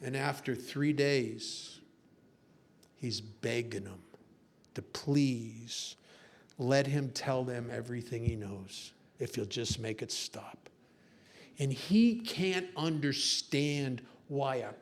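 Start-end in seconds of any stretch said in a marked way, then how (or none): 2.9-10.7 low, soft, defeated narration; grave, spaced-out hush; hushed storytelling. A narrator's reading. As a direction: drop low and quiet for the breakdown. Hushed telling of the spy broken.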